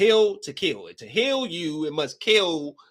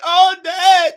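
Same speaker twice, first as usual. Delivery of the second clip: high, loud hammed-up wail; overplayed movie sorrow; mock grief; 10 dB louder than usual. Mourning character wailing for a dead father, hammed up.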